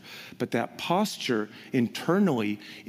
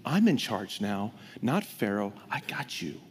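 low, softer voice of God promising care; God's quiet reassurance; 7 semitones lower, 5 dB quieter than usual. Acted. God quietly reassures freed slaves, low and soft. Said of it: "God saying".

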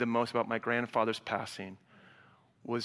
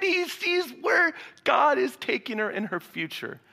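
fervent shout; 11 semitones higher, 4 dB louder than usual. Real, not acted. Preaching climax to congregation.

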